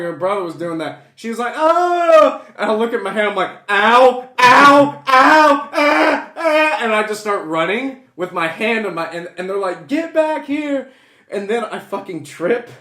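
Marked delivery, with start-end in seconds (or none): 1.5-2.4 loud, high mock wail; imitated crying wail. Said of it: "she started crying". 3.7-6.8 loud, high repeated yelps; mock cries of pain. Acts out panicked cries while fleeing. 9.9-10.9 high, drawn-out parental yell; mock angry call. Acts out parents calling after him.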